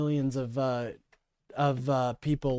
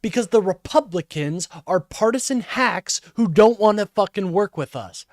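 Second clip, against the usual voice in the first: higher, louder, exasperated tirade; angry rant; 6 semitones higher, 7 dB louder than usual. Real, not acted. Streamer answering a senator's clip, angry rant. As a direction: fire back loudly, pitched up and indignant.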